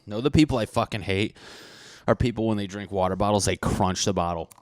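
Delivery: normal talking voice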